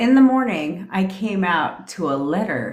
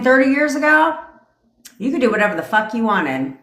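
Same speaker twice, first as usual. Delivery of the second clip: higher, louder burst on the years; emphatic; 6 semitones higher, 4 dB louder than usual. Real, not acted. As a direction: hit it hard like a rant. Emphatic rant about old days.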